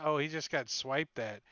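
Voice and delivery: normal talking voice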